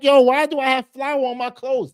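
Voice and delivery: loud, high-pitched baffled complaint; mock exasperation. Customer finding flour on the clothes, mock exasperated. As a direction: act out an indignant customer.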